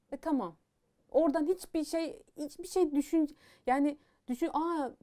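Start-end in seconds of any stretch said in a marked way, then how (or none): 4.5-4.9 a higher little aa of wonder; lightly surprised aa; mock surprise. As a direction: pretend to be amazed.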